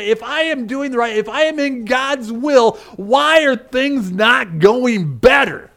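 high-pitched, mounting complaint; exasperated protest. Acted. Preacher imagines beaten Paul questioning God, exasperated.

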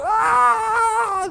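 put-on a sudden high, loud wail of a kid not getting his way; kid's tantrum scream. Preacher mimics a little kid's tantrum, a loud high scream. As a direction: imitate a bratty child's frustrated scream. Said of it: "a little kid when they don't get his way and all of a sudden they go".

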